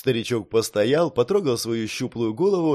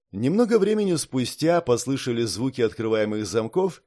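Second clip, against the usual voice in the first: plain reading voice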